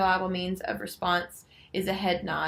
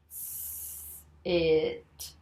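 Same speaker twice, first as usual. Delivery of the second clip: slow blend. Shown as a demonstration. Teacher blending along with Jim, slow 'S. It'. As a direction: draw out 'S. It' slowly. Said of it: "together with me slowly".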